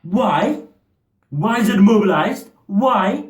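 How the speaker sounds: high-pitched imitated indignation; offended. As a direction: wail it in mock outrage, pitch shooting up. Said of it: "like, so offended".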